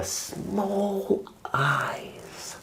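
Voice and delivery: hushed narration, breathy whisper. A narrator's reading. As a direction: whisper the line softly.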